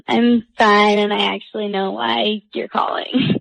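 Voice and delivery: ordinary delivery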